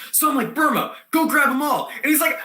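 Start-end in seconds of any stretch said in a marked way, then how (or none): 0.5-1.9 high, raised-voice order; urgent command. Acted. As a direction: send a friend charging in. Acts out sending Burma after gnomes.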